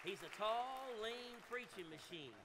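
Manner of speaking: loud, high hype for the next preacher; excited introduction. Real, not acted. Pastor hyping the preacher to the congregation, loud and high. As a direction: sell him to the crowd with a loud, high lift.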